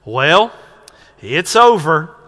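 grandmother's North Carolina accent, lightly imitated. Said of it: "in her North Carolina accent".

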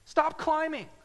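high, loud cry of no; preaching rebuke at full force; emphatic rebuke. Real, not acted. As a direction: stop them cold with a sharp no.

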